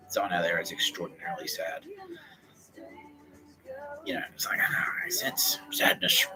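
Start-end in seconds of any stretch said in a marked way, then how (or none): 4.6-6.3 slow, airy seer's whisper; mystic whisper. Acted. Speaker acting out sensing sadness from afar, slow whisper. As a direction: murmur it like a psychic in a trance.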